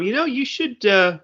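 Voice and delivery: high, bright retold advice; quoting himself. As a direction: coax mom to learn chopsticks. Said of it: "So I said to my mom".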